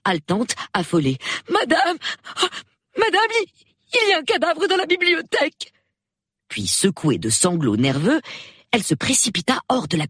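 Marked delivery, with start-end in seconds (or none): 1.5-5.7 very high, frantic maid; panicked, breathless. A narrator's reading. Maid bursts in to alert her mistress, panicked and breathless. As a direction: gasp out terrible news in a high panic. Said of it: "la voix de Marie s'éleva, haletante, affolée".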